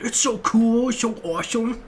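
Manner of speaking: put-on high, eager kid voice; little kid gushing. Mocking himself as a kid gushing over the song. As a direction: gush like an excited little kid. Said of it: "I feel like a little kid describing it".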